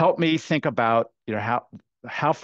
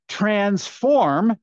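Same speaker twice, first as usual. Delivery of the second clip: "Transform" drawn out, higher and louder; emphatic, raised "Transform"; stress on TRANSFORM; 7 semitones higher, 4 dB louder than usual. Shown as a demonstration. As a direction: lean hard and long on "Transform". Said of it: "emphasis on the verb transform".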